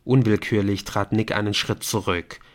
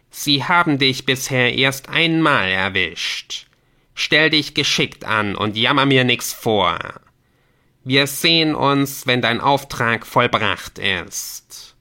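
raised, emphatic dismissal, slowing at the close; imperious game messenger; 4 semitones higher, 5 dB louder than usual. Acted. Messenger sends player off on illegal task, imperious. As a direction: send them off with stern authority.

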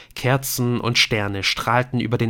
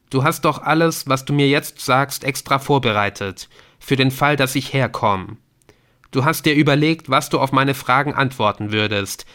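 raised, tense questioning; probing son; 4 semitones higher, 4 dB louder than usual. Acted. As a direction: press him for the truth. Adrian questions his father. (angespanntes, drängendes Nachfragen)

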